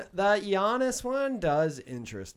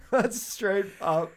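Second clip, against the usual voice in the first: laughing; 5 semitones higher, 7 dB louder, slower than usual. Real, not acted. Laughing suggestion.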